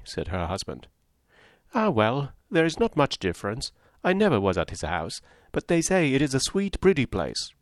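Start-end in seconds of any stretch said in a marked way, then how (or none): 1.8-7.5 high, breathless society gossip; chattering Mrs Palmer. Acted. Mrs Palmer prattling to Eleanor and Marianne, high and chirpy. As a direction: prattle on brightly without pausing.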